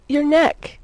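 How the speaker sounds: high, loud plea; urgent begging lift. A narrator's reading. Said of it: "Lois fairly begged".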